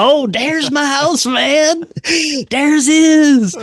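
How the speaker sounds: very high, drawn-out excited cry. Retold or imitated. Passenger spotting his house from the truck, so excited. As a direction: squeal it out on spotting home. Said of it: "I was so excited".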